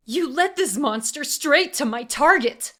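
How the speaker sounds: loud, high, furious accusation; snapping. A narrator's reading. Assassin accusing the auctioneer, furious. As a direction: lash out at him. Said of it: "Emery snapped".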